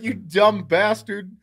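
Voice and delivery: high, loud, slow exasperated insult; affectionately appalled, retold with gusto; mock scolding. Son berating dad in retelling.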